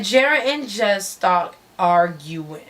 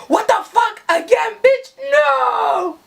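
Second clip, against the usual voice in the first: outraged yell; 11 semitones higher than usual. Retold or imitated. Jared outraged at second job.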